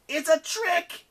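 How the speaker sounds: high, loud yell of warning. A narrator's reading. Eris warning of a trick, yelling. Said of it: "Eris yelled".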